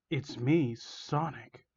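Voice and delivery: slightly deepened, fading at the end; quiet, ominous reveal; chilling villain voice. Acted. Villain reveals himself to victim, lightly chilling. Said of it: "a chilling, deep voice".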